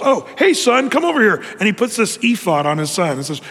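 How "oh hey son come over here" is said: hammed-up shout to his son; calling out. Micah calls his son over to be priest, mock call.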